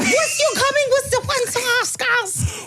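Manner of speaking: slow, very high mock accent; exaggerated falsetto put-on accent; Martina. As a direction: drag out a squeaky imitation of her accent. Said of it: "keep this accent like this".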